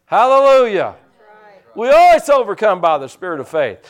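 sudden high, loud preacher's cry; preaching at full force. Real, not acted. Preacher rousing the congregation, loud and high. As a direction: lift the room with a shouted hallelujah.

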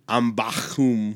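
Ambakum slowly, with a throaty kh, am-bakh-OOM; Greek pronunciation. Shown as a demonstration. Host repeating the Greek name, Ambakum said slowly with throaty kh. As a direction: draw out Ambakum, scraping the middle kh. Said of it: "The Greek, uh, pronunciation".

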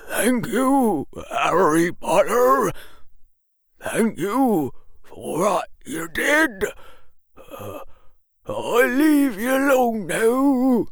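put-on high, tearful elderly quaver; quavering old man. A narrator's reading. Old man thanking the boy before leaving, quavering. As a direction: give halting thanks in an old man's high voice. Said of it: "quavered the old man".

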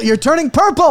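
high-pitched cry of alarm; acted, rising alarm. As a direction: panic at what you see. Alarm at injured man.

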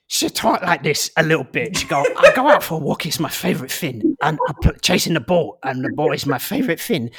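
put-on gruff, simple-minded dog with a mashed-up accent; gruff French bulldog. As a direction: growl it out like an easily delighted little dog. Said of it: "And her voice is".

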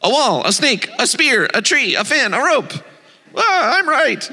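high, rising storybook argument; mock squabbling. A narrator's reading. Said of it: "six blind men fighting about the elephant".

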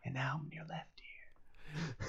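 soft unvoiced ASMR whisper; whisper in left ear. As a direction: breathe it slowly into the left ear. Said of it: "I guess it's A S M R then".

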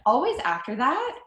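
ordinary talk, nothing marked

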